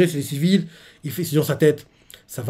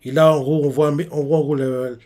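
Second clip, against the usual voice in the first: usual speaking voice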